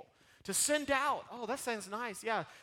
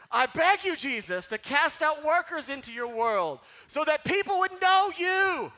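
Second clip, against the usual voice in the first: loud fervent plea; 7 semitones higher, 8 dB louder than usual. Shown as a demonstration. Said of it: "Let's all say it together".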